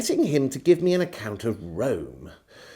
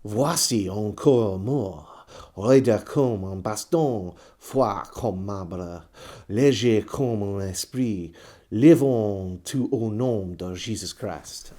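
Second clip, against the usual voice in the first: low, slow chanted French charm; solemn incantation; 5 semitones lower, slower than usual. Acted. Reciting the Bordeaux charm to Brisbane, low and slow incantation. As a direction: intone it like a spell. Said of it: "The words these".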